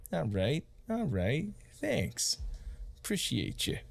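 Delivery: lightly voiced NPC with a pitch jump up; a hint of a character voice, rising high on thanks; Oz thanking them. Oz thanking the party, in a lightly put-on voice.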